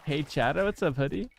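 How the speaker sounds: normal talking voice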